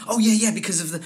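mock the over-keen newbie gushing; eager young actor. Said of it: "all of a sudden they're going".

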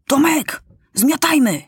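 high-pitched alarmed call-out; sudden urgent call. A narrator's reading. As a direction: call a friend to bolt, urgently. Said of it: "zawołał nagle Felek".

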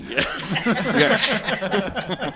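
laughing yes. Real, not acted. Cracking up mid-scene.

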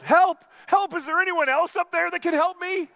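very high, loud panicked shout; yelling for help. Acted. Jack on the cliff shouting high and loud for another helper. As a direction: scream for anyone else up there. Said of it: "Jack yelled".

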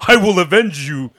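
a laughing, hammed-up barbarian hero vow; Conan impression. Conan vowing revenge for a beheaded friend, a laughing mock-heroic voice. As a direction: play it as a grand, jokey oath. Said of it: "'cause Conan always says some stuff like that".

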